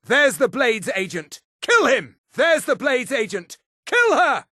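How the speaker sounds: high, urgent battle cry; high-pitched combat yell; calling out. Acted. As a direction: sound the alarm and order the attack. Guard calls out attack on agent.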